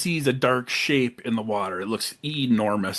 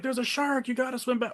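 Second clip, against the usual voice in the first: high, softer urgent warning; mock warning girlfriend; 10 semitones higher, 5 dB quieter than usual. Girlfriend warning her man in the water, high soft urgent voice. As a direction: pitch up and plead with him.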